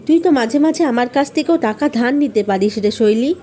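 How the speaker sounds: ordinary delivery, nothing marked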